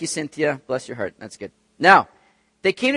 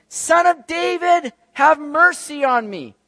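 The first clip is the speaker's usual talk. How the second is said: slow, high, loud shout; full-voiced acted plea for mercy; crying out louder; 10 semitones higher, 8 dB louder, slower than usual. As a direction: yell it out all the more, stretching the words. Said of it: "he cried out all the more".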